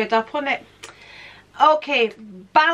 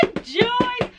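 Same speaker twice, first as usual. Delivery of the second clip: mock despair; 12 semitones higher than usual. Real, not acted. Sarcastic cry about tiredness.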